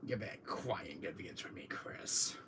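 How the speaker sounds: whispered smug villain; Wesker impression. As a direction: murmur it with cold menace. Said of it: "And he goes like this to him".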